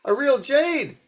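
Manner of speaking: high, loud, astonished emperor voice; suddenly gasping at the jade's worth; emperor amazed. Retold or imitated. Teacher voicing the third emperor, high and astonished. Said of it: "and the emperor says".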